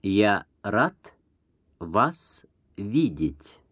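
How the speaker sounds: ordinary talk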